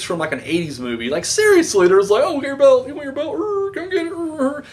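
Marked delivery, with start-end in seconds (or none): 2.2-4.6 mock heel taunt. Wrestlers taunting over belts.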